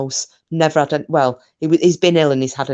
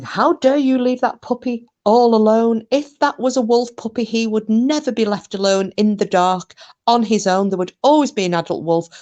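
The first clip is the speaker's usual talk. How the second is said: higher-pitched mock-indignant scolding; imitating an outraged, brusque ticking-off; brusque telling-off; 7 semitones higher, 3 dB louder than usual. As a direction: scold like a brusque friend who is appalled. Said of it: "They come across very brusque".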